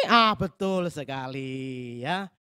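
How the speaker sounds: usual speaking voice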